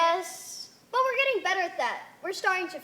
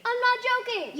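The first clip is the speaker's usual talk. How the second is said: insistent protest; 8 semitones higher, 5 dB louder than usual. Acted. Insists against elders.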